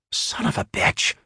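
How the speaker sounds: muttered curse under breath. A narrator's reading. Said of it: "Marjorie muttered".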